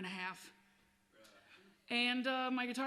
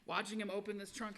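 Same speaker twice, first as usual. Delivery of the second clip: normal talking voice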